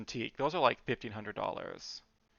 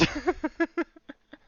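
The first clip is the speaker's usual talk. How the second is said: high laugh; 5 dB louder than usual. Real, not acted. Laughs at own imitation.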